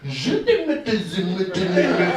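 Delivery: high gibberish French mumble; mock French. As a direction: fake French, garbled and quick. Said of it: "He was like".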